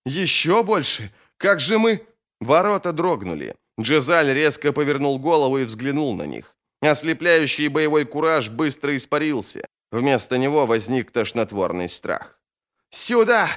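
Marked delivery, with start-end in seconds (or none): 0.1-2.0 high-pitched, anxious questioning; alarmed question. Acted. Frightened man hearing more enemies are coming, high and anxious. 12.9-13.5 high-pitched urgent call; roared. A narrator's reading. Man at the open door desperately calling him in, roaring. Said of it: "взревел Моровия".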